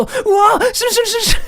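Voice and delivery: high, loud anime wail; anime style. Acted. Kid mortified in front of teacher, anime-style high wail. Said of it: "sounds just so much like anime".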